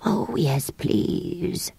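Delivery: soft, feeble creature; exhausted. Acted. Creature gratefully accepting a rest, soft and exhausted. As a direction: let it out faintly.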